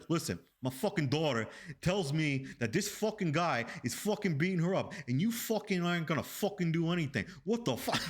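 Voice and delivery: ranting New York father, mobster style; mafia-movie dad. Retold or imitated. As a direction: play the furious detective dad like a movie gangster. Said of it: "this guy sounded straight out of a mafia movie".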